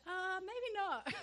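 high, drawn-out reluctant verdict; mock awkward hesitation. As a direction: stretch it out high, laughing. Imitates friend's awkward verdict.

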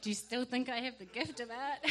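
amused retold question tipping into laughter; laughing question. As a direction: ask it as a self-mocking joke. Jokes about her bad drawing.

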